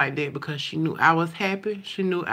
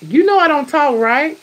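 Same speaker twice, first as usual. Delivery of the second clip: mimicking daughter; 9 semitones higher, 6 dB louder than usual. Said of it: "echoing her".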